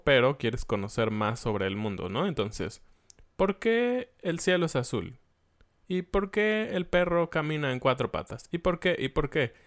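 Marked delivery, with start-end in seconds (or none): 3.4-9.5 put-on high, eager little child's questions; curious child. Small child pestering parents with questions, high child voice. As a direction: play a toddler asking endlessly. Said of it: "Sobre todo cuando eres niño".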